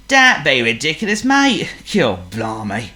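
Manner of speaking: loud cockney dog voice; Woof the dog. Acted. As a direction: scoff like a rough dog.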